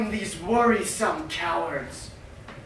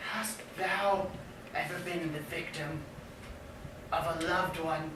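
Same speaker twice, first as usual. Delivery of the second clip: hushed and low, much softer; quiet; 3 semitones lower, 10 dB quieter than usual. Acted. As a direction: drop the voice to a hush. Question repeated, first take hushed.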